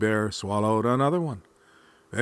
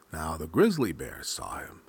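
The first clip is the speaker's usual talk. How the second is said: usual speaking voice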